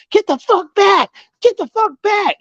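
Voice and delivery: very high mock shriek; acted screeching command; imitated irate sister-in-law. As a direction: order someone back in a put-on high voice. Sister-in-law yelling at her, retold.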